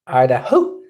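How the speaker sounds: "Idaho" as "aɪdaho", broad Scottish vowels; full Scottish "Idaho"; Scottish accent. Retold or imitated. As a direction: roll "Idaho" out like a Scot. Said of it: "From bonny old Scotland".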